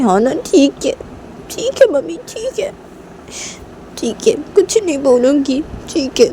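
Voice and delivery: high-pitched, huffy giving in; sulky compliance. Acted. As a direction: agree grudgingly, pitch jumping up. Scolded daughter sulkily gives in.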